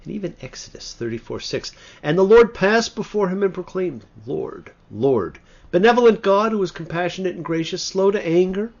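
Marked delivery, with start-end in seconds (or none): 2.0-8.7 loud, high proclaiming of the verse; declaiming scripture. Acted. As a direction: ring out the angel's call from heaven.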